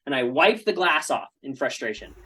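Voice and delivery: normal talking voice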